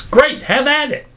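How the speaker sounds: high, dismissive cheer; mock-encouraging send-off; sarcastic approval. Real, not acted. Sarcastic approval of costly research.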